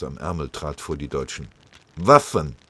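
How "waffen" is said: loud, barked demand for weapons; threatening soldier's loud interrogation; broken-German demand. A narrator's reading. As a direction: bark it curtly in broken German. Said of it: "radebrechte er".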